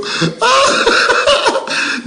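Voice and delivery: a high, airy burst of laughter. Real, not acted. Laughing mid rant.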